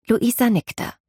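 plain reading voice

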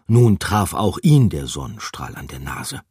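plain reading voice

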